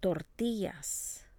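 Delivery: "Tortillas" slowly, weight on TI; stress next to last syllable. Shown as a demonstration. Teacher models the rule for repeating, stress next to last syllable. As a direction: draw out "Tortillas", leaning on the middle syllable. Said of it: "stress next to the last syllable".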